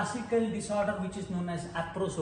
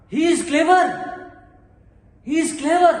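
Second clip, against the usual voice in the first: higher and much louder, exclaiming; exclamation; 6 semitones higher, 10 dB louder than usual. Shown as a demonstration. Teacher demonstrating an exclamatory sentence, loud. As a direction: burst it out as an exclamation. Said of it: "exclamatory mark".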